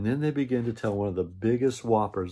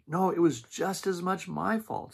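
put-on high childlike insistent voice; eager little sister; 9 semitones higher than usual. Sister bear insisting to Mama it was her fault too, high and eager. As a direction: pipe up like a little girl taking the blame.

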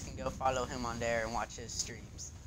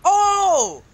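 sudden high shout of surprise; real startled yelps; startled cry; 11 dB louder than usual. Real, not acted. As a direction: yelp in shock. Surprised game reaction.

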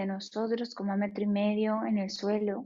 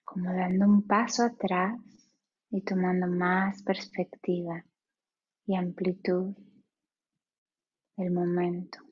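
guided meditation. Acted. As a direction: ease them into stillness, unhurried.

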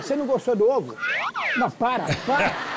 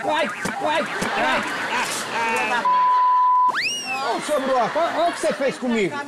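falso susto; 5 semitones higher than usual. Acted. Finge ser atacado por fantasiado.

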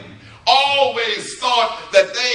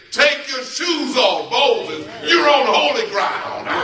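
raised, higher preacher's proclamation; commanding voice of God; 6 semitones higher, 4 dB louder than usual. Acted. Voice from the burning bush to Moses, commanding. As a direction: call it out as a divine command.

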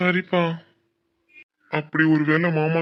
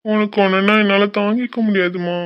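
anguished pleading; 3 semitones higher than usual. Acted. Son pleading with his crying mother, increasingly anguished. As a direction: implore her, unable to bear her tears.

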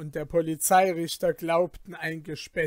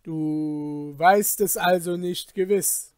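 terrible voice; 7 dB louder than usual. Acted. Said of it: "mit schrecklicher Stimme".

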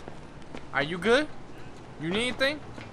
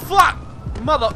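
usual speaking voice

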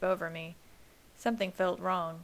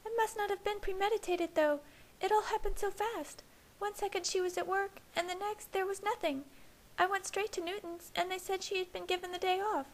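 put-on much higher, worried young woman; Alice voice; 12 semitones higher than usual. A narrator's reading. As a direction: voice the anxious sister.

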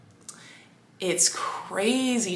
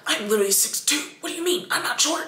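squeaky, much higher mock outrage; indignant disbelief; 8 semitones higher, faster than usual. Tall TikTok men answering the short-king comment, hammed-up high indignation. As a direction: imitate a tall man insulted at being called short. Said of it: "they'd be like".

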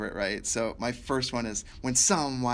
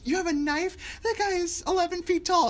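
much higher, mock-incredulous voice. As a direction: imitate someone in high-pitched disbelief. Retold incredulous reaction to a knife.

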